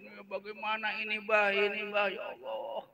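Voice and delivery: high-pitched plaintive pleading to father; children whining at their dad in distress; imitating wailing children. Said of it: "nangis semua anak-anaknya". (menirukan anak-anak merengek sambil menangis)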